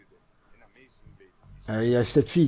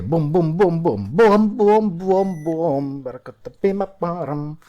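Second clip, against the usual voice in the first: higher, booming mock-Italian intonation; Italian sing-song imitation; 5 semitones higher, 3 dB louder, much slower than usual. Shown as a demonstration. Gamer mimicking how Italians talk, Italian sing-song. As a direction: exaggerate Italian lilt on nonsense syllables. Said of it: "Les Italiens, ils parlent comme ça".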